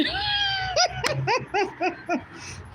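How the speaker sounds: long, high peal of laughter; real, drawn-out belly laugh; cracking up. Real, not acted. Cracks up at viewer's comment.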